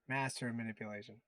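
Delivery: normal talking voice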